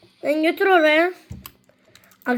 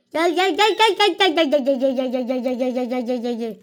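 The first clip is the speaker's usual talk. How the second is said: rapid-fire calling; 3 semitones lower than usual. Real, not acted. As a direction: rattle off a machine-gun string, pitch sliding down. Rapid chant of calling, trailing down. (hızlı hızlı, giderek alçalarak çağırma)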